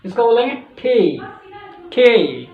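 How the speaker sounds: ठी with aspirated th; English letter T. Shown as a demonstration. Teacher showing natives' letter T. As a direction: say ठी, breathy and soft. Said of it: "ट को ठ की तरह बोलेंगे".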